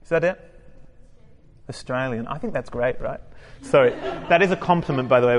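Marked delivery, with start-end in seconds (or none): 0.0-3.2 playful imitation of an Australian accent; light mock Australian accent. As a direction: put on a teasing Australian accent. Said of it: "When I go for the accent".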